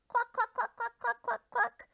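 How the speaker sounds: very high, loud clucks; hen clucking. Acted. Hen takes her turn waking the farm with high clucks. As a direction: cluck like a hen trying to crow. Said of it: "she crowed".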